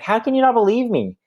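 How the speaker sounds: high, disbelieving plea as a wounded father; a hurt father asking how his son can doubt him; father who can't believe his son won't recognise him; exasperated. Retold or imitated. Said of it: "what he's saying is like".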